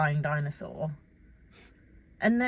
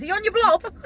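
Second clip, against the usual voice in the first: high, loud attempt at a Scottish accent; 13 semitones higher, 9 dB louder than usual. Quoting Billy Elliot to co-host, loud Scottish accent. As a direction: belt out the film quote in would-be Scottish. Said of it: "said it in a Scottish accent".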